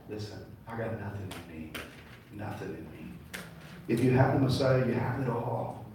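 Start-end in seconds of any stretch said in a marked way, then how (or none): 0.1-3.1 softer, lower humble words; lightly voiced Ruth, humbled; quiet confession. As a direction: let it sink to a hush. Ruth's confession voiced softly.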